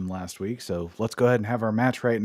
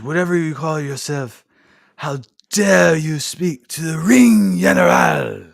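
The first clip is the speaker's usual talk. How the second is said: slow, loud, higher shouted German-tinged bark; Kaiser impression; 6 semitones higher, 8 dB louder than usual. Kaiser scolding McIntyre for disrespect, slow loud shout. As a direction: thunder it like an outraged henchman. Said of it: "Like that".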